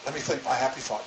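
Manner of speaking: dropping to a soft murmur; hushed self-soothing mantra. Retold or imitated. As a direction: say it again softly to yourself. Sarah repeats her calming thought.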